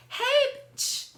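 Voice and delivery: high, loud mock-chummy hail; fake-friendly greeting. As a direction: imitate her acting like it's all good. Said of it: "like, we're cool, like, everything's all good".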